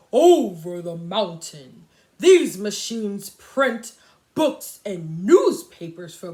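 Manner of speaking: slow, loud, haughty engine voice; bellowing freight engine. Acted. Proud freight engine refusing the toys, loud slow bellow. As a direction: boom it out with a proud attitude. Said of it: "the big, strong engine bellowed".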